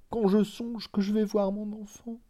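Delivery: a mother about to see her son, overcome; overwhelmed stammer. A narrator's reading. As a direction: falter out the thought of her child. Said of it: "Elle balbutia".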